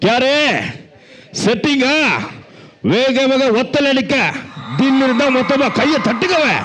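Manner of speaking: high, swaggering superstar voice; superstar impression. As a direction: put on a star's punchy delivery. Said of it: "இன்னொரு celebrity வந்திருக்காரு".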